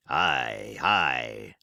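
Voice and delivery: low growling pirate ay; low pirate Silver. Acted. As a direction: drop deep for Silver's drawn-out ay.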